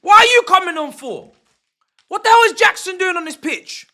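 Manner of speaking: loud, high demanding shout; angry shout. Real, not acted. Streamer shouting at Jackson on the pitch, loud and high. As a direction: demand an answer from the screen. Outraged at substitution.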